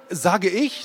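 put-on a kid pulling rank; stubborn boy. A narrator's reading. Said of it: "sagte ich". (Junge kontert trotzig, höher und lauter)

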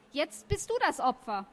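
heated, triumphant retort; yelled at him. A narrator's reading. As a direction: turn the taunt back on the bully. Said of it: "schrie ich ihn an".

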